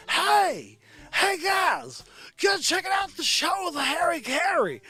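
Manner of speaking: high, loud announcer shout, half sung; booming ballpark announcer calling the crowd in; Harry Caray impression. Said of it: "the Harry Carey vibe".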